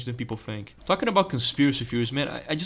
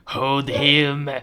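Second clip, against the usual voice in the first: raised, drawn-out command; forceful villain order; 4 dB louder than usual. Acted. Doctor repeating the order to restrain, insistent villain.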